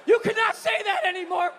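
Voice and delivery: high, scolding mock outrage; imitated wife flipping out. Wife scolding her husband for a slur, mock outraged. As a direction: lay down the law, imitating a shocked wife. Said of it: "flips out".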